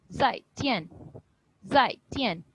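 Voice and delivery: "zai tian" short, each syllable falling; unstretched falling tone. Shown as a demonstration. Teacher has students hear the difference, unstretched falling tones. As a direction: drop quickly on zai and tian, no stretch. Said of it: "कोई stress या stretch नहीं कर रही".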